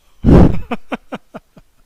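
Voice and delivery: a big loud laugh that breaks out; loud burst of laughter. Real, not acted. Streamer to chat about the insurrection, loud real laughter. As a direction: let the laugh explode out of you.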